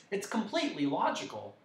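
high, eager lecturing voice; explaining dodecahedron. A narrator's reading. Dodecahedron teaching Milo arithmetic, high and keen. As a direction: reason it out brightly in a high voice.

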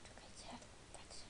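hushed whisper to the viewers. Real, not acted. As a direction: whisper it, sneaking up. Sneaky hush to viewers.

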